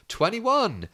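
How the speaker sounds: high, called-out bingo number; calling out the number. Retold or imitated. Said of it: "They go".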